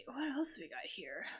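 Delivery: whispered aside to self; muttered whisper. Real, not acted. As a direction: breathe it under your voice while searching. Aside while looking for next clue.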